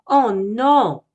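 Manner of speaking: a bear who finds his honey jar empty; dismayed exclamation. Acted. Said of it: "dit-il".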